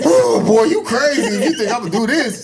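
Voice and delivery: high, fast and laughing; worn-out fighter laughing at friends; laughing refusal. Retold or imitated. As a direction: laugh off the idea of getting up. Exhausted reply to friends.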